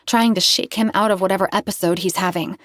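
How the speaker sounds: ordinary delivery, nothing marked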